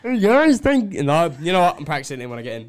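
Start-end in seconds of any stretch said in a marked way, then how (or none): 0.0-0.9 high frog voice attempt; Kermit voice. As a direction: reach up for Kermit. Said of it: "the Kermit voice".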